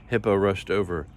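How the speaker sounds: usual speaking voice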